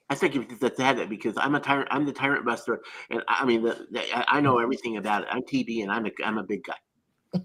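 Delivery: low, subdued self-important tough guy; Tyrant Buster. Acted. Showing off an impression to co-hosts, low braggart voice. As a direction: drop it low and boast haltingly. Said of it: "What do you think of my Tyrant Buster's impression?".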